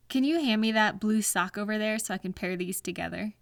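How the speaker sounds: ordinary talk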